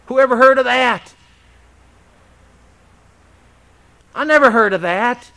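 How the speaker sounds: loud, incredulous outcry; indignant exclamation. Real, not acted. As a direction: reject the idea with disbelief. Preacher's indignant outburst.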